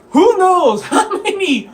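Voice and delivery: high, loud, slow laughing delivery. Real, not acted. Player jokes about old tropes, laughing. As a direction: talk right through the laughter. Speaker laughs while talking.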